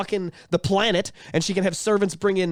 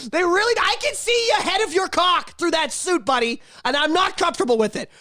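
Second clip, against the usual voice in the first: hammed-up rant; 8 semitones higher than usual. Ranting at cyclists in tight suits.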